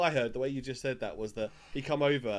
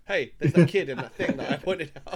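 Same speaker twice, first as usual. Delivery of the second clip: laughing; 3 semitones higher, 8 dB louder than usual. Pretend. Imagines own brain recognising him.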